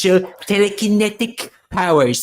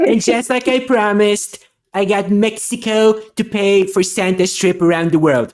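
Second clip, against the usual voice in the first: Trump impression. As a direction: brag like Trump at a rally. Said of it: "And now Trump's gonna climb in Santa's sleigh".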